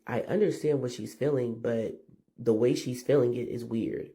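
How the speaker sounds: ordinary delivery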